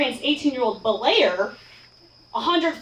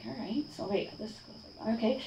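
very soft, half-voiced retold agreement; hesitant muttering; 3 semitones lower, 13 dB quieter than usual. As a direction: act out agreeing under your breath. Retold hesitant answer to instructor.

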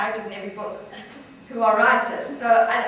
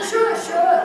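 loud, high, eager yes; quick-to-accept enthusiasm, raised and loud; eager acceptance; 9 semitones higher, 10 dB louder than usual. Acted. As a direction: jump at the offer. Man eagerly accepts a drink.

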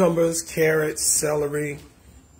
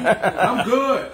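laughing; 7 semitones higher, 8 dB louder than usual. Real, not acted. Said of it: "Tony, you sound hoarse".

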